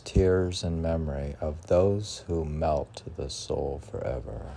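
slow, hushed poem recital sinking low; poem reading. Acted. Reads translated poem aloud.

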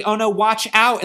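loud alarmed warning. Acted. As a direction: shout the warning. Mindy warning.

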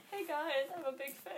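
high-pitched put-on fan voice; fake fan. Podcaster posing as a random account, fake fan voice. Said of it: "We make up random accounts".

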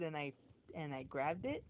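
usual speaking voice

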